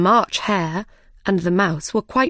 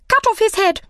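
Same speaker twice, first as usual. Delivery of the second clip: very high, loud royal shriek; Queen crying out; 5 dB louder, faster than usual. A narrator's reading. Queen ordering the Knave's execution, high-pitched cry. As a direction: shriek the order, far higher and louder. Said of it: "cried the Queen".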